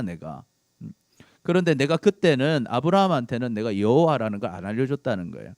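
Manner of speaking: ordinary delivery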